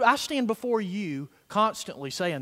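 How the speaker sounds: normal talking voice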